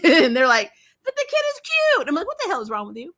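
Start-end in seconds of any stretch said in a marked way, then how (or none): 1.0-2.1 high-pitched, quick mimicry; mock gushing. Co-host mimicking teammates excusing the baby, mock gushing. Mocking others' reply to Carol. 2.3-3.1 mock disbelief. Retold outraged reaction, laughing.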